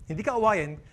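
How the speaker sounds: ordinary talk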